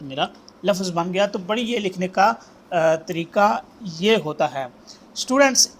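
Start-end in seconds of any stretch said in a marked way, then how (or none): 5.2-5.8 raised, higher call for attention; calling the students. Real, not acted. Teacher to calligraphy students, raised attention call. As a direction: call the class to listen up.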